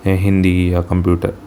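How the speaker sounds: ordinary talk, nothing marked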